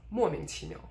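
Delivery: 莫名其妙 with high 莫 and light 名其妙; first stressed, others de-stressed. Shown as a demonstration. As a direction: stress 莫 and let 名其妙 go short and toneless. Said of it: "stress the first one and de-stress the other ones".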